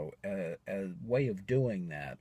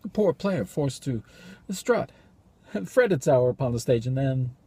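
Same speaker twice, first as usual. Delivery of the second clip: bleak soliloquy; 10 semitones higher, 11 dB louder than usual. Acted. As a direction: deliver it as a halting, despairing soliloquy. Performs a despairing soliloquy.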